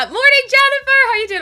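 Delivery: high, loud good morning; excited greeting. Real, not acted. Streamer greeting a viewer in chat, excited. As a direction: sing out the name.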